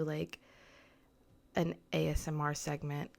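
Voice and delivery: ordinary talk, nothing marked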